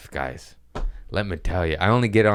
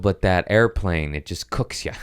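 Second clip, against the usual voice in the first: ordinary talk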